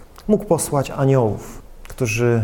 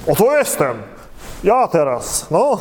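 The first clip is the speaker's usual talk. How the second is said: proud self-offering; 3 semitones higher than usual. Shown as a demonstration. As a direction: show off, pleased with yourself. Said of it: "nie w duchu".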